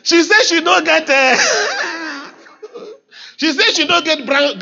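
high-pitched, amused through laughter; laughing. Real, not acted. Pastor relaying what she said to the congregation, laughing. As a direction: relay it while breaking into laughter.

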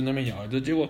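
usual speaking voice

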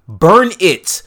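loud, high exclamation; mock-outraged outburst; appalled retold order. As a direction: blurt it out as an appalled command. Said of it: "her reaction is".

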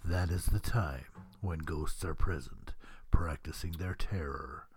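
deep, slow ghostly narrator; low and slow haunted-house host; slow theatrical villain menace. Acted. Spooky haunted-house narration intro.

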